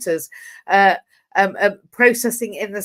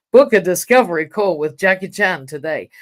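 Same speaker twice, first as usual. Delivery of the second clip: hammed-up American hype accent, a bit louder; mock American accent; 6 dB louder than usual. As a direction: sell it like a cheesy American ad in a bad American accent. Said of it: "I do a ridiculous American, not very good accent".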